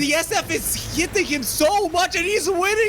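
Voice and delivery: high, loud hype call; excited play-by-play. Acted. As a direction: call the fight at full pitch. Play-by-play of final fight.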